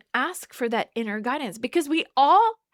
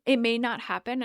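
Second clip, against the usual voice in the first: ordinary talk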